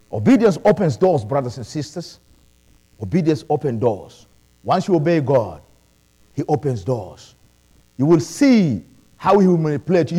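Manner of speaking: ordinary talk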